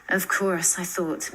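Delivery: ordinary delivery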